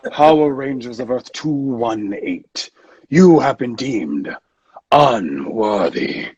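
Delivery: slow, pompous pronouncement; weary, lofty arbiter. Acted. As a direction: pass judgement as if above it all. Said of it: "he often sounds tired of it".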